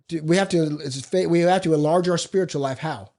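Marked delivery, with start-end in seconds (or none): none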